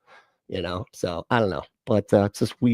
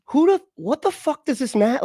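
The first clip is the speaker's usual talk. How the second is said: exasperated outburst; 13 semitones higher than usual. Real, not acted. Exasperated at parents.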